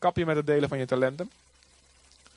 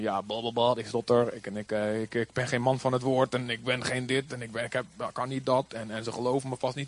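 low, soft, stammering excuses; mumbling Moses making excuses; 8 semitones lower, 6 dB quieter than usual. Retold or imitated. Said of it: "Mozes zegt van". (mompelend en aarzelend als Mozes)

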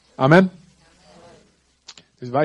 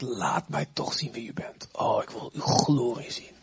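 whispered, slow and softer; longing whisper; 5 semitones lower, 5 dB quieter than usual. Acted. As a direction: breathe it as an intimate prayer. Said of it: "Hij zegt".

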